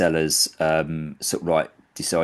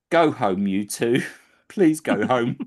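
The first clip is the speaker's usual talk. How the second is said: weary, higher plea to give up; exasperated police; 7 semitones higher, 3 dB louder, slower than usual. Retold or imitated. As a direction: sigh them off home, fed up. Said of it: "the police officer just goes".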